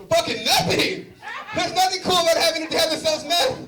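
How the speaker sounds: loud, high exasperated outburst; mock rant. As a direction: blow up at the dumb question. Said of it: "I'm like".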